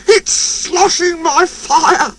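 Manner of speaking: high, slow dismayed engine voice; high slow engine lament; dismayed Percy. A narrator's reading. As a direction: drag it out in dismay. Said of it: "hissed Percy".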